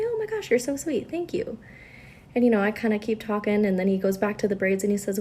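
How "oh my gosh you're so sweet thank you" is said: much higher, bright polite thanks; sweet polite voice. Retold or imitated. Nurse thanks the old patient, much higher and sweet. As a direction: gush sweetly at the compliment. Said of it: "And I say".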